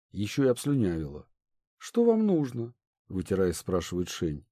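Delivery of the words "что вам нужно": put-on lightly higher, poised young noblewoman; a polite princess, lightly voiced. A narrator's reading.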